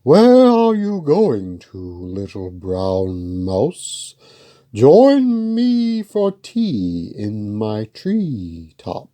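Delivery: a grand owl drawing out the invitation; hooting owl. Acted. Owl voiced in story.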